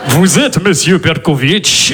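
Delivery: put-on slow, low heavy Russian accent; hammed-up Russian mobster. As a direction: menace in a thick Russian accent. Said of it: "une entrée genre film de flic russe".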